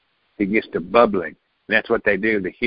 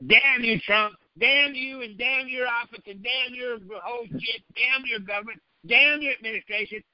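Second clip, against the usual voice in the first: mock outraged curse; 11 semitones higher, 4 dB quieter than usual. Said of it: "why don't they say".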